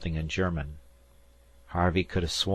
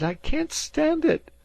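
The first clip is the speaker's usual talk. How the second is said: even higher, loud and slow; the second cry breaking higher; anguished cry; 5 dB louder than usual. A narrator's reading. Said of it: "cried Dan".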